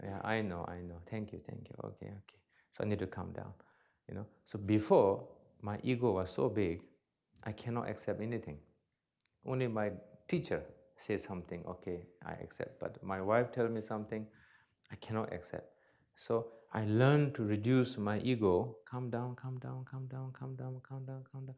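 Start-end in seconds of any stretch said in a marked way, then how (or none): 0.0-2.4 meek reply. Retold or imitated. Meek reply to his wife. 18.9-21.6 calming self-talk. Shown as a demonstration. Repeating a calming mantra to himself.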